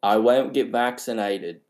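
rough attempt at Aussie; amateur mock Australian accent. Shown as a demonstration. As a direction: try it as an Australian. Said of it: "Let's start with Australian".

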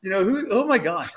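high, loud laughing disbelief, retold; imitating a friend cracking up with laughter. Friend hears the story and cracks up, laughing. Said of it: "He's, like, cracking up".

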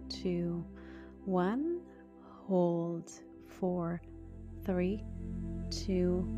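hushed, slow meditation voice; soft spa whisper. Acted. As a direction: breathe the words softly, spa calm. Continues guided breathing.

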